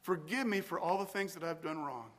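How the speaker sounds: quiet, fading confession; modelling a prayer of confession. Shown as a demonstration. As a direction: confess softly, letting it fade away. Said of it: "And I pour out here".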